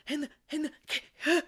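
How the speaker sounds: acted gasping, high and broken up; out-of-breath panic. Storyteller imitating Tony after running upstairs, breathless mock panic. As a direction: gasp between words as if panicking. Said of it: "all out of breath and panicking".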